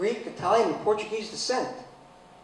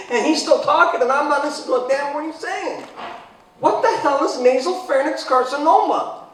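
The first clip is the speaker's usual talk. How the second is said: usual speaking voice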